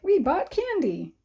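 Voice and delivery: put-on a demo of an excited sentence for kids. Shown as a demonstration. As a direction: read "We bought candy" like you can't wait to tell. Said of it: "let's say you're excited that you bought candy".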